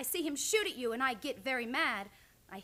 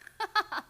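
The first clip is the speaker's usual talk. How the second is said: bright teasing laugh, higher and louder; playful high laugh; 8 semitones higher, 5 dB louder than usual. Acted. Narisha surprising Matt in the dark, playful laugh. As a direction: laugh at being caught out.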